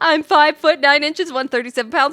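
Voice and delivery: loud, fast, eager self-introduction; bubbly intro. Acted. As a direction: rattle off facts excitedly. Hyper character introduces herself.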